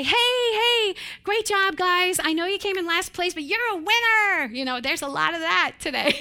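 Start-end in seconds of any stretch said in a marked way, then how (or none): 0.0-4.5 much higher, louder mock cheer; hollow cheering. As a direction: pump up empty praise like a fake coach. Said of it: "if I just say".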